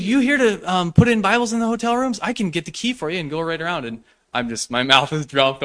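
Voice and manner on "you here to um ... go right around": very high, loud, delighted welcome; overjoyed hotel clerk. Retold or imitated. Hotel clerk welcoming the Gideons, very high and loud with joy. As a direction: gush like a clerk thrilled to see them. Same clerk offers the key.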